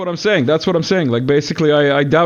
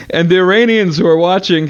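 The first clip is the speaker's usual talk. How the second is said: ordinary talk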